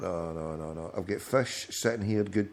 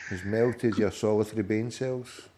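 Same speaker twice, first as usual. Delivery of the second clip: ordinary talk, nothing marked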